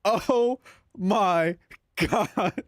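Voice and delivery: words broken by real laughter; can't get it out for laughing; laughing through oh my god. Real, not acted. Cracking up at the picture.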